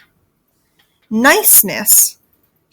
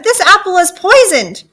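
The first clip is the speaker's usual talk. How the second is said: high, loud alarm; urgent cry at raised pitch; shouted warning; 9 semitones higher, 7 dB louder than usual. Acted. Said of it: "Gertie shouted".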